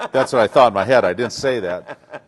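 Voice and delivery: laughing loudly through the words; can't hold back the laugh. Real, not acted. Telling the interviewer his secret thought, laughing.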